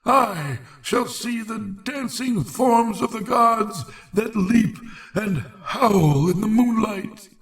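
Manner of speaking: high, frenzied wise man's cry; frantic raving. A narrator's reading. Old sage shouting to the heavens, high and frenzied. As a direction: rave at the sky in terror. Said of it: "Whilst Barzai was shouting these things".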